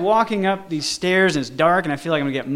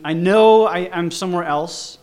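ordinary talk, nothing marked

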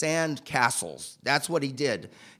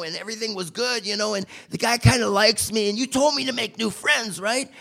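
a lightly voiced, higher [name], eager and rambling; [name]'s voice; 6 semitones higher than usual. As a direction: play the eager buddy talking himself up, a touch higher. [name] explaining himself, voiced.

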